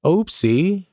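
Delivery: a sillier second oopsie. Real, not acted. Oopsie squeaked up at the camera in self-mockery. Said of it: "self-mocking oopsie repeated".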